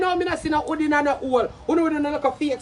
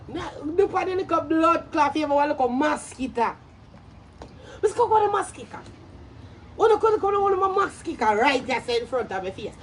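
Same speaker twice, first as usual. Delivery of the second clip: usual speaking voice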